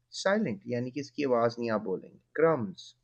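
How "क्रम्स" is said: क्रम्स said as krums, no b sound; silent b. Shown as a demonstration. Teacher showing the class: क्रम्स without the b. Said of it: "क्रम्ब्स नहीं कहेंगे".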